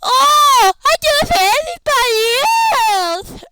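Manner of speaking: a rival mocking the champion's speech; mocking whine. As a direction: sneer it back like a bratty rival. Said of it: "Kevin Moxley said".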